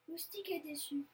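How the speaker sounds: usual speaking voice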